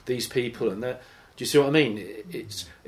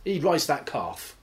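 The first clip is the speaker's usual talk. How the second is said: mock-casual tip-off, higher; cheeky local; 5 semitones higher than usual. A local breezily sends the seeker to the new priest, mock-casual. Acted local pointing visitor.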